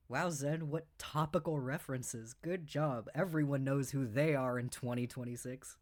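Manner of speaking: sarcastic aside under the breath; deadpan sarcasm. Real, not acted. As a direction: murmur the compliment with a straight face. Sarcastic praise of dated references.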